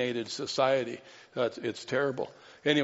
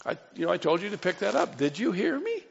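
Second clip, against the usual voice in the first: higher pitch, rising insistent question; parent scolding kids; 7 semitones higher than usual. Acted. Parent to kids who were told to pick up, insistent questioning. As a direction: play the parent checking the kids listened. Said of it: "you say to the kids".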